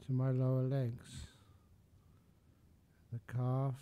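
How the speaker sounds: soft, unhurried body scan with long pauses; guided meditation. Real, not acted. Meditation teacher talking listeners up from feet to legs, soft guided meditation.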